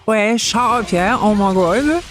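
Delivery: lightly imitating the locals back home in their English accent; hometown English accent. Speaker mimics people from her English hometown, mock English accent. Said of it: "people from my hometown who are like".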